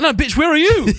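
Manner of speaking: high, loud mock-outraged comeback; exasperated high-pitched yelp at a caller; indignant retort. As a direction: throw the question back, half laughing, punching YOU. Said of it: "I'm like".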